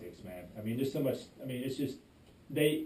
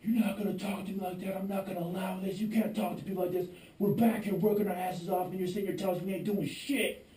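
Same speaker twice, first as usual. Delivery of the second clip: higher-pitched heated retelling of going off; a mild replay of losing it, pitched up; retold blowup; 7 semitones higher than usual. Replaying telling off the boss, mildly heated. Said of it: "I literally lost it".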